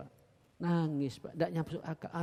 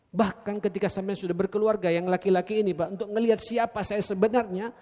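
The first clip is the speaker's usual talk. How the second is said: preaching; 4 semitones higher, 6 dB louder than usual. Acted. Preacher addressing husbands.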